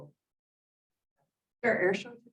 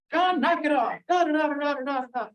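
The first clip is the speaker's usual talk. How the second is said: imitating an angry neighbor scolding; mock angry scolding; 8 semitones higher than usual. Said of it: "she got so mad at him".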